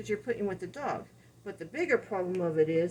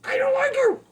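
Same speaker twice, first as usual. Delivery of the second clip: much higher, much louder shout; yelled overreaction; 12 dB louder than usual. Shown as a demonstration. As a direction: blow up at the dog with a furious yell. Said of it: "you're going".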